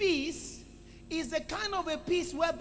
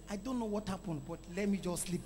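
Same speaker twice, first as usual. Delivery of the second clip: low, soft, drowsy mumble; sleepy reply; 7 semitones lower, 8 dB quieter than usual. Retold or imitated. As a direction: retell it as if nearly asleep. Retelling his sleepy reply to wife.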